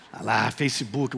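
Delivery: ordinary talk, nothing marked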